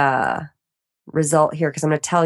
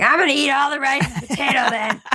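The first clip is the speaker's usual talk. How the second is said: gleeful defiance; 7 semitones higher than usual. Retold or imitated. Rice and potato came up negative.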